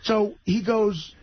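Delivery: normal talking voice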